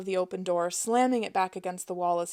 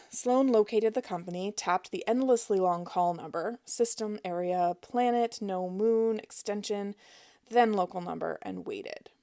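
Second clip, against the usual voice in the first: ordinary delivery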